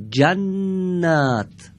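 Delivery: جنت drawn out very slowly, the doubled ن held; slow demo word. Shown as a demonstration. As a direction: read جنت slowly for the class. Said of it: "بعدی رو هم از شما میپرسم".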